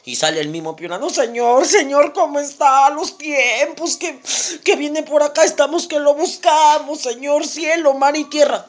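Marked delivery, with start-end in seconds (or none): 1.1-8.7 high, drawn-out, excited welcome; effusive greeting. Acted. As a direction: hail the long-sought doctor with relief. Piurano recibe efusivo al doctor. (saludo efusivo, agudo y alargado)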